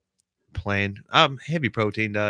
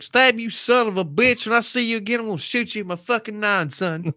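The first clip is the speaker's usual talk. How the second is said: high-pitched mock threat; Bob; 9 semitones higher than usual. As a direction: put on a high, comic tough-talker voice. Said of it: "Bob's probably like".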